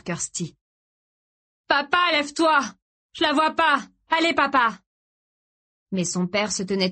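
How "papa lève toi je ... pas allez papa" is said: high, panicked pleading; frantic urging. A narrator's reading. Son urging his collapsed father to get up, frantic. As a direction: urge him up in a raised, desperate voice. Boy urges dying father up.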